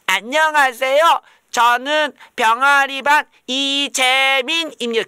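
high, loud, slow schoolkid introduction; hammed-up goody-goody kid; kid's recital. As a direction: recite it like a proud kindergartener. Child reciting self-introduction.

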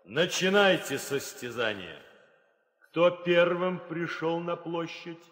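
loud, high ringing call to the whole square; royal proclamation. Acted. King opening the bridegroom contest, loud proclamation. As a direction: proclaim it so the crowd hears.

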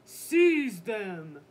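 loud royal command; big commanding shout. A narrator's reading. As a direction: bellow the order as a storybook king. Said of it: "the Dragon King shouted".

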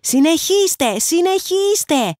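high, slow desperate pleading; desperate plea. A narrator's reading. Playwright pleading with his actors, desperate and high. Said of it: "φώναζε ... απελπισμένος".

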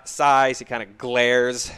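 ordinary talk

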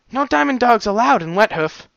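high, firm refusal; guard refusing. Acted. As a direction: shut him down flatly.

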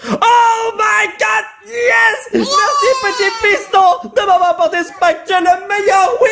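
high, crying-out joyful yell; ecstatic shout. Real, not acted. Thanking the son for the pack, ecstatic yelling.

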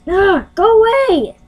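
high, whiny shooing cry; exasperated outburst. Real, not acted. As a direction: cry out at the popup that won't leave. Yells at script error popups.

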